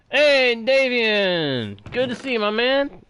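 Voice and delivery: high, loud, drawn-out hello; cheerful greeting. Real, not acted. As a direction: call out happily to a buddy. Greeting a friend.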